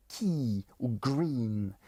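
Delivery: "key" and "green" with drawn-out I; long vowel. Shown as a demonstration. Teacher illustrating long vowels, drawn-out "key" and "green". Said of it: "besoin de voyelles longues".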